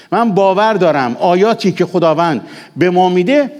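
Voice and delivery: usual speaking voice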